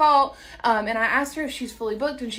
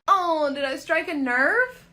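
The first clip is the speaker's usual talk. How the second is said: ordinary delivery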